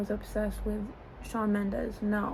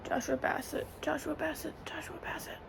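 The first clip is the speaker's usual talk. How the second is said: breathless whispered name, fading; swooning whisper; 6 semitones higher, 6 dB quieter than usual. Real, not acted. Lovesick fan whispering her crush's name.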